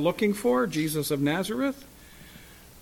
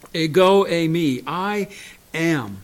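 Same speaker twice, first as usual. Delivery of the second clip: forceful declaration; 5 dB louder, slower than usual. Retold or imitated. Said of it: "Jesus says of himself".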